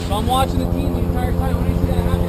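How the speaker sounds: loud, pressing play-by-play of the stop; demo narration. Shown as a demonstration. Guide acting out spotting a pooping dog, loud and urgent. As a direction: talk it through loudly as if on the sled. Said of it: "look a little something like this".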